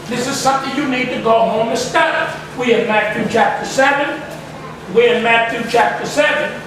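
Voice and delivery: normal talking voice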